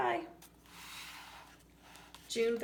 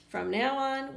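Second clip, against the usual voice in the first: ordinary delivery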